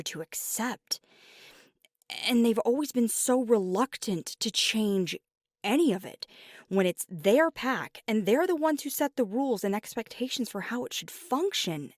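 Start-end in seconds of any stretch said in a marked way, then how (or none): 2.3-11.9 raised voice, higher and louder; frustrated venting. Acted. Venting to partner about parents' reluctance to change, frustrated outburst. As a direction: vent loudly, pushing hard on the key words.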